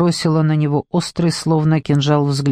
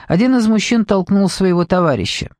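usual speaking voice

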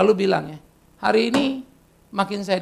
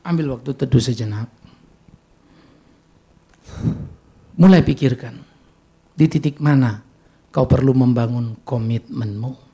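guided reflection; 7 semitones lower than usual. Acted. Leads quiet reflection.

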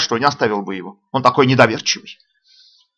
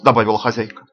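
plain reading voice

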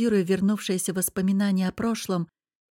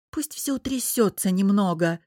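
usual speaking voice

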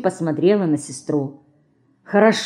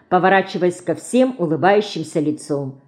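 usual speaking voice

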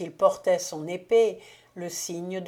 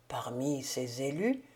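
hushed breathy whisper, lower; murmured; 6 semitones lower, 5 dB quieter than usual. A narrator's reading. Stunned count whispers the dead wife's words. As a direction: whisper it back in disbelief. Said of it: "murmura le comte".

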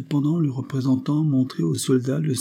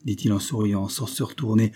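plain reading voice

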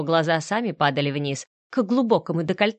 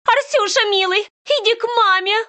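much higher, louder shrill call; hysterical calling; 5 dB louder than usual. A narrator's reading. Вика calls Арсюша hysterically to come to her. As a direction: screech for the boy.

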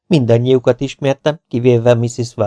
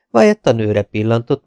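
plain reading voice

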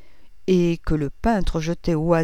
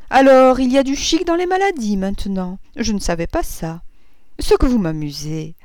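loud, high outburst, settling as it goes; sudden amused exclamation; 4 semitones higher, 6 dB louder than usual. A narrator's reading. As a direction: burst out in amusement, then ease down. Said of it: "s'écria-t-elle tout à coup". (s'écrier tout à coup, amusée, puis redescendre)

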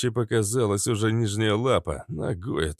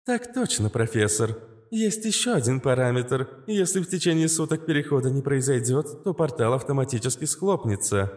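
higher, even machine-like AI; calm AI voice; 6 semitones higher than usual. A narrator's reading. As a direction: explain it like a calm AI. Astra explains.

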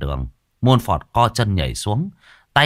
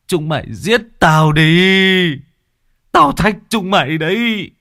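high, loud, slow defiant cry; choked-up fury; 9 semitones higher, 5 dB louder than usual. Acted. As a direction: dare them to kill him, voice strained. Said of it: "Ông uất nghẹn". (gào lên uất nghẹn, cao và to)

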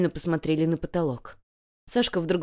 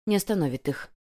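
ordinary delivery

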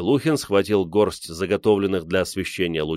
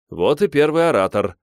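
higher-pitched, gleeful announcement; delighted; 7 semitones higher, 4 dB louder than usual. A narrator's reading. Солдатов greets the growling dog as first speaker, delighted. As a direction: crow with amused delight. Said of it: "обрадовался Солдатов".

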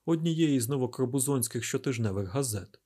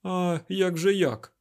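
raised, drawn-out exclamation; slow, louder, higher-pitched protest; exclaiming; 7 semitones higher, 5 dB louder, slower than usual. A narrator's reading. Isaac objects to Derkhan's argument, exclaiming in protest. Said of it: "вигукнув Айзек".

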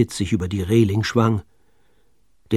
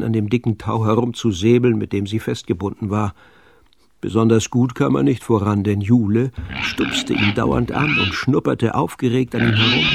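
plain reading voice

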